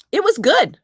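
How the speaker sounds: high, loud burst of surprise; surprised. Real, not acted. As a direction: blurt it out, caught off guard. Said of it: "I'm really surprised it was good".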